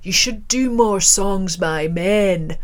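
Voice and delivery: slow, put-on Scottish accent. Scottish man advising a female musician, slow Scottish accent. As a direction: draw it out like a Scottish punter. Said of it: "so forgive the accent".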